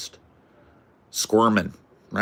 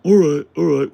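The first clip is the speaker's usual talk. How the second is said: mock backing down; 7 semitones higher, 8 dB louder than usual. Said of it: "He's like".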